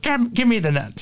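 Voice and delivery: snatch the net before the fish gets away; urgent demand. Retold or imitated. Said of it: "Jason's like".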